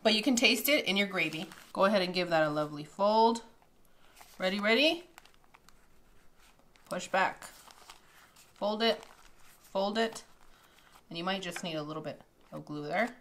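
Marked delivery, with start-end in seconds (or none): none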